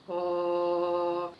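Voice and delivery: low held "oh" from the chest. Shown as a demonstration. Teacher has children feel the chest vibrate, low held note. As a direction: sustain a low, steady "oh". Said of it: "façam um som grave".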